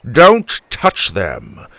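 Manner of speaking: slow, loud warning growl. Acted. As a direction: grandpa protecting the boy. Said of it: "Grandpa growled".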